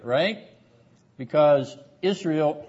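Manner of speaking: ordinary delivery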